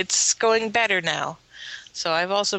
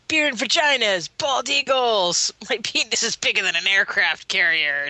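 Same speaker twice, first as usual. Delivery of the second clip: mock American; 5 semitones higher than usual. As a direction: shout it like a dumb proud American. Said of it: "how American accents sound to the British".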